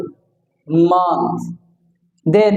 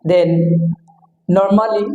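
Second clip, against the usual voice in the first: ordinary delivery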